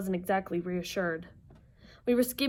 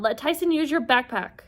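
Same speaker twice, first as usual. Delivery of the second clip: higher, louder urgent order; yelling; 5 semitones higher, 5 dB louder than usual. Acted. Percy to Annabeth as Tyson feels sick, yelling. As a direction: call out the quick fix. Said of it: "I yelled".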